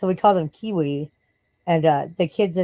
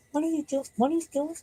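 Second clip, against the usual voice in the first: much higher, coaxing the dog; high dog-talk voice; 11 semitones higher, 6 dB quieter, faster than usual. Real, not acted. Owner to dog chewing things, high pet voice. Talking to her misbehaving dog.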